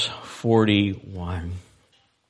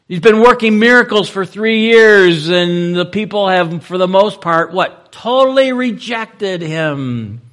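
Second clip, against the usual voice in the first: much higher and louder, slowing on the last words; rising sermon climax, slowing at the end; full-force preaching; 9 semitones higher, 7 dB louder than usual. Acted. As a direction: preach it out to the back pews.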